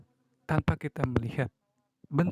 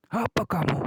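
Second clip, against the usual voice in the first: sharp angry retort, higher and louder; angry snap; 6 semitones higher, 4 dB louder than usual. Shown as a demonstration. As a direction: snap back in mock anger. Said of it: "kita marah, pasti kan kita akan membentak". (bentakan marah)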